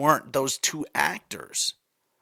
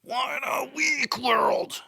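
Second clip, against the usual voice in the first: slow wailing cry, much higher; mock anguished cry; 8 semitones higher, much slower than usual. Mocking the request, slow drawn-out cry. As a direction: wail it like the world is ending.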